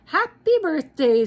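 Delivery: loud, high happy snowman; Frosty's greeting. Acted. Frosty speaking as the hat lands, loud and high. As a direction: greet everyone like you just woke up. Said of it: "said Frosty again".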